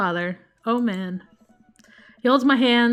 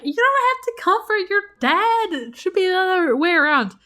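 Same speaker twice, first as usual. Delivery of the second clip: daughter voice; 9 semitones higher than usual. Acted. Voicing daughter Amanda's dialogue.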